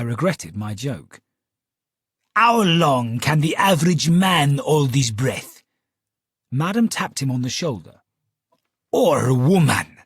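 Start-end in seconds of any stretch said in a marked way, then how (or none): 2.3-5.6 slow, loud Italian magician voice; a stage magician's Italian accent, drawn out; Italian showman accent. Acted. Magician Borelli addresses audience. 8.9-10.0 slow, loud Italian magician voice; a stage magician's Italian accent, drawn out; Italian showman accent. Acted. Borelli's voice continues.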